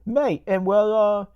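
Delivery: high mock English voice; Creepy English. Creepy English stopped by police, high mock voice. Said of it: "this English piece of shit".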